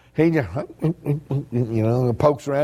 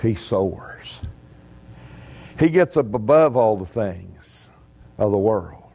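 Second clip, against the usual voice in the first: normal talking voice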